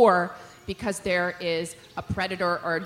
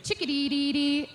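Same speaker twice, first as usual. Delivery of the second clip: chickadee call; 5 semitones higher, 4 dB quieter than usual. Shown as a demonstration. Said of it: "be like".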